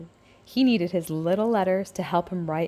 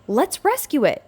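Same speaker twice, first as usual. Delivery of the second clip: high, bright call of an idea; eager exclaim; 7 semitones higher, 4 dB louder than usual. A narrator's reading. Little letters finding the old truck, excited high call. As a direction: pitch it up like an eager kid. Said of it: "they exclaimed".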